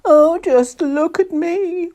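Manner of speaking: loud, high, slow wail; drawn-out theatrical lament. A narrator's reading. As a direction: wail in self-pity as a snowman. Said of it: "wailed the snowman".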